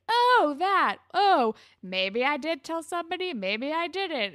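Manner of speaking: high, loud, sing-song taunt that slows at the end; taunting Spinner. Retold or imitated. Spinner lording the secret over Marco in a high, loud, teasing voice. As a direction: dangle it teasingly, drawing out the last words.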